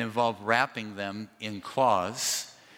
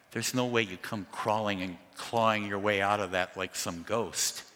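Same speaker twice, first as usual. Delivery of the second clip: ordinary talk, nothing marked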